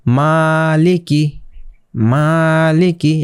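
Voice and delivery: মালিকি drawn out slowly, the long a held one beat; slow it right down and hold the a in মালিকি; one alif stretch. Shown as a demonstration. Teacher showing the class how to read মালিকি slowly. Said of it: "এখানে এক আলিফ টেনে পড়তে হবে".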